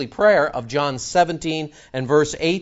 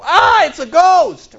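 frightened cry; 11 dB louder, slower than usual. Acted. Disciples frightened by Jesus appearing.

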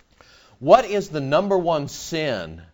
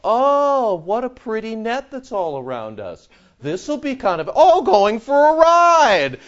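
foolish fish; 7 semitones higher, 4 dB louder than usual. Pretend. Preacher voicing fish admiring the net, mock dopey delight. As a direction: play the happy clueless fish. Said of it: "the fish go".

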